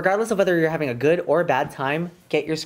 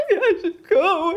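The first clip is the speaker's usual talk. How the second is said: high whine dissolving into laughing cries; mock whiny quitter; 14 semitones higher, 3 dB louder than usual. Alexis considering leaving, high laughing whine. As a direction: whimper it like a quitter. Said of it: "She's like".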